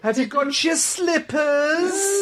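slow, high coaxing voice; mock soothing carer. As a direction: coax him gently like a nurse. Mock calming an old man.

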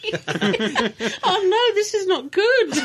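fighting the giggles; trying not to laugh; giggling. Real, not acted. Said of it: "[name]'s got the giggles".

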